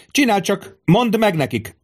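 very high-pitched yelled order; narrated roar. A narrator's reading. Tribune orders the centurion, furious roar. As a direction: insist at the top of your range. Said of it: "ordította a tribunus".

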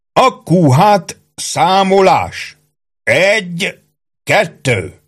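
high, very slow parade-ground call; bellowed command. A narrator's reading. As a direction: draw out the count like a drill sergeant. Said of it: "ordította Ike". (elnyújtott, ordító vezényszó)

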